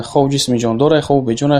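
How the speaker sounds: usual speaking voice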